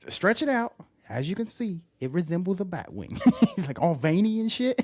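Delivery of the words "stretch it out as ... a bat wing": high put-on voice; mimicking a character. Retelling a movie scene to his cohost, high mock voice. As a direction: show it off in a silly high voice.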